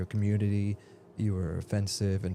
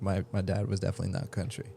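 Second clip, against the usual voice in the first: usual speaking voice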